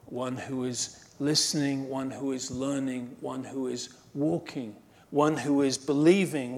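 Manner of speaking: normal talking voice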